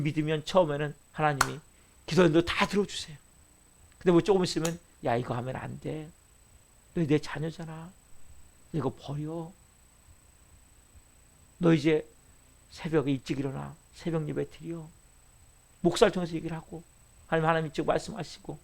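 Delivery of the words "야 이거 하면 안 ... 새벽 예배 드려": 1 soft voice of God. Acted. God's voice quoted to believer.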